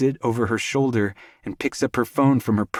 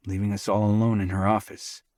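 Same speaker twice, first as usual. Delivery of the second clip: usual speaking voice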